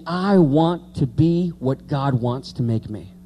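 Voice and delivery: ordinary delivery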